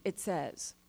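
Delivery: ordinary delivery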